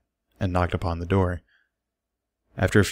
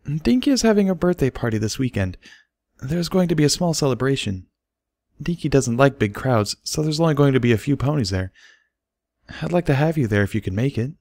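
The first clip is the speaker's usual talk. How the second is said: higher, flustered invitation; 6 semitones higher than usual. A narrator's reading. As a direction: squirm through the invite. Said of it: "Derpy said in flustered tones".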